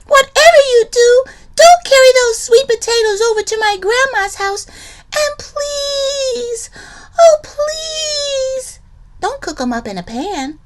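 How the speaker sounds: very high, loud mock sobbing plea; pleading cry. Liza Lou tricking the haunt with a high, loud wailing plea. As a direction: wail the reverse-psychology plea over the top. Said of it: "cried Liza Lou".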